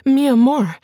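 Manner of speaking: frightened pleading wife. Acted. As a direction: cry out mi amor in fear, stress on mi. Bianca pleads in fear.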